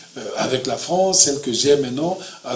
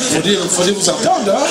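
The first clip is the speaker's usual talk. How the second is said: laughing as he speaks, a little louder; 6 semitones higher, 5 dB louder than usual. Real, not acted. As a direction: says it through a chuckle. Amused remark about his accent.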